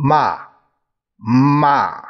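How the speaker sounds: “嘛” started high and dropped steeply; 高降调示范. Shown as a demonstration. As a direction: demonstrate a falling fourth tone on “嘛”. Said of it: "高声往下降". (“嘛”由高往下降，读去声)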